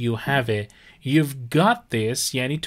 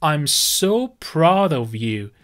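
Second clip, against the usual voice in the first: slow, careful, every word separate; slow model phrase; 2 semitones higher, much slower than usual. Shown as a demonstration. Teacher presenting a new phrase to students, slowly. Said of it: "proud of یعنی افتخار".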